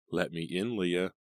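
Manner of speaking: plain reading voice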